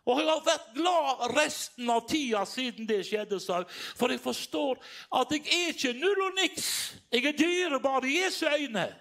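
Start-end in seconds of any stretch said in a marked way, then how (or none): 4.0-8.9 high, loud, exultant proclamation; preacher proclaiming to the congregation, fervent and loud; preaching at full force. Acted. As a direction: lift it up like a revival preacher. Preaching climax.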